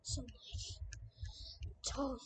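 unvoiced hushed whisper. Real, not acted. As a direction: whisper it tensely. Quiet tense moment.

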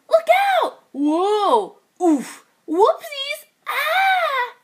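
high, loud startled yelps; high startled cries of a falling band; crash cries. Acted. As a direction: yelp as everyone tumbles.

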